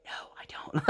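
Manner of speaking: unvoiced, flat denial; whispered retort. Mom whispering her own reply to her daughter. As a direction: whisper back like a tired parent.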